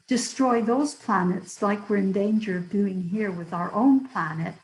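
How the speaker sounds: ordinary delivery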